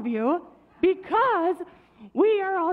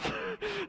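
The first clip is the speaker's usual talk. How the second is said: soft, breath-light real laugh; helpless laugh; 5 semitones higher, 11 dB quieter than usual. Real, not acted. Children's leader laughing mid-question at own voice, helpless laugh. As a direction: laugh quietly at yourself.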